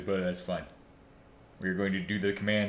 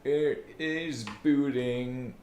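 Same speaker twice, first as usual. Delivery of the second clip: mock announcer; 5 semitones higher, much slower than usual. Announcing boot in odd voice.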